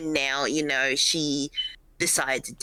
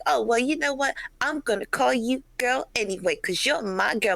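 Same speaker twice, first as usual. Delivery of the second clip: mother's voice pitched up, sugary and insistent; mocking the mother; 8 semitones higher than usual. Telling a friend how the mother insisted on calling her girl, mock mother voice. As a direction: mimic her sweet, higher-pitched voice. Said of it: "she was like".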